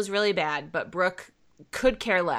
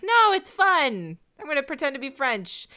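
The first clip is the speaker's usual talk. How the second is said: mock Brenda; 7 semitones higher than usual. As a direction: imitate Brenda brushing off her friends, lightly. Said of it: "Brenda's like".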